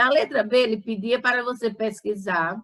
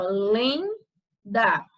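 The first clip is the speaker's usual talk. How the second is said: LENDA broken slowly into "len", pause, "da"; split into syllables. Shown as a demonstration. Teacher separating the word for students, syllable by syllable. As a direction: sound out "lenda" one syllable at a time. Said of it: "você iria separar, ficava".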